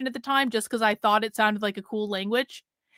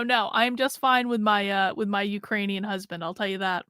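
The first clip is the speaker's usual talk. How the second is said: normal talking voice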